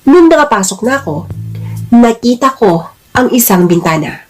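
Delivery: ordinary delivery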